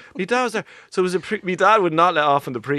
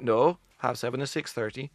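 Finnish correction; 5 semitones lower, 5 dB quieter than usual. Retold or imitated. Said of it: "They went".

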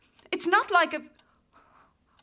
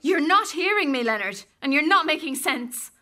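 louder, stern insistence; raised, exasperated scolding; firm rebuke; 4 semitones higher, 6 dB louder than usual. Acted. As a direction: put your foot down. Wife firmly pushes back.

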